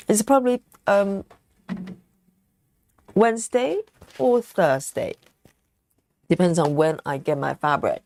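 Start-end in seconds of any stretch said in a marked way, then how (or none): 0.1-5.2 words round a bite of chocolate; eating chocolate. Real, not acted. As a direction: mouth full of chocolate. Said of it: "I've got chocolate in my mouth".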